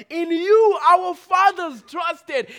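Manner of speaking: loud, high and slow; slow shouted line. Acted. Preacher proclaiming the fathers' trust to the congregation. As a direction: hammer each word out.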